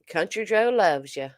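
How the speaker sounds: a light country sign-off; lightly voiced country catchphrase; imitating a catchphrase. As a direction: tip your hat to the chat like Country Joe. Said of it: "she had a lot of good catchphrases".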